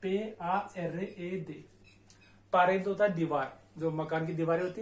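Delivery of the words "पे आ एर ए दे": very slow, letter by letter; teacher dictates slowly; spelling out letters. Shown as a demonstration. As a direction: spell पे आ एर ए दे with a pause after each letter. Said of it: "पहला शब्द पारेद".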